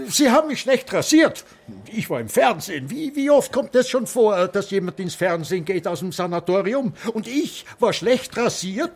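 put-on high, loud, offended old man; indignant complaint. Old patient complains to the nurse about his shave, high and indignant. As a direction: protest loudly at the shaving.

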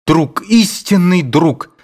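slow, moved voice with tears; tearful. A narrator's reading. Aduev greets his friend, slow and tearful. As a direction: say it slowly, choked up. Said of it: "со слезами на глазах".

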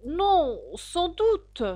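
coaxing. A narrator's reading. Alice tries to calm the angry mouse, coaxing. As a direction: soothe it with a caressing tone. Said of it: "d'une voix caressante pour l'apaiser".

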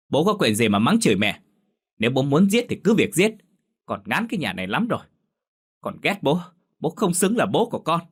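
high, rising defiance; defiant anger. A narrator's reading. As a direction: throw the words back at him. Daughter defies violent father.

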